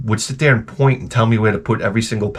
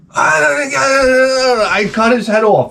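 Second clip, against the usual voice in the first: high, drawn-out mock wail into words; hammed-up unhinged high-pitched cry; crazy outburst imitation; 11 semitones higher, much slower than usual. Teased man snapping back, mock crazy outburst. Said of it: "He'll say something crazy like that".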